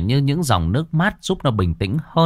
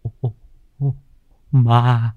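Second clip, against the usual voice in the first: terrified stammer; 4 semitones higher, 3 dB louder than usual. A narrator's reading. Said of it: "tiếng nói khe khẽ".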